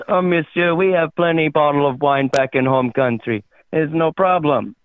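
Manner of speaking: put-on untroubled comic French accent. French guy boasts of plenty wine back home, French accent. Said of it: "That's good French".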